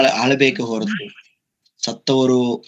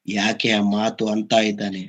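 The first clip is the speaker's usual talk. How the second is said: normal talking voice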